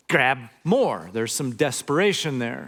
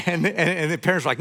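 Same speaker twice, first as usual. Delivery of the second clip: ordinary talk, nothing marked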